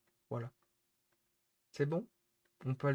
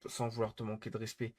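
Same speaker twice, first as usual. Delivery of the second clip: usual speaking voice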